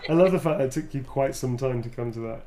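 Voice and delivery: ordinary talk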